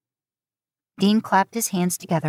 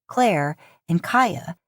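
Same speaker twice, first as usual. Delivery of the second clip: plain reading voice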